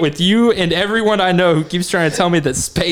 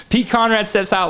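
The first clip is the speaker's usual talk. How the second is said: usual speaking voice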